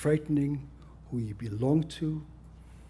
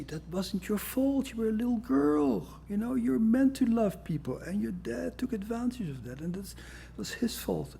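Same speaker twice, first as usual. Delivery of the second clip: reassuring therapist; 10 semitones higher than usual. Retold or imitated. Therapist soothing a trauma patient, gently mocking. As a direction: comfort the patient gently, a touch sugary. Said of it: "And you say".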